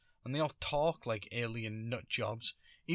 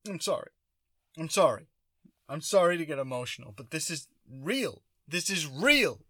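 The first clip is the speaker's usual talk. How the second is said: hammed-up emotional apology, higher and louder; mock choked up; 4 semitones higher, 6 dB louder than usual. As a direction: imitate a grown man getting tearful mid-interview. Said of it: "They got choked up and emotional".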